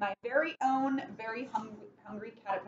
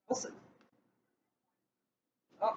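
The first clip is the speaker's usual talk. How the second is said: normal talking voice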